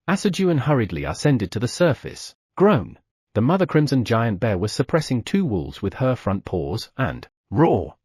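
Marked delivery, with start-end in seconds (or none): none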